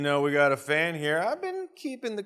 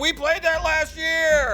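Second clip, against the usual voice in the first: high, slow, loud put-on voice; camera guy voice; 13 semitones higher, 7 dB louder, slower than usual. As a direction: voice the deadpan cameraman. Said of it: "the camera guy's like".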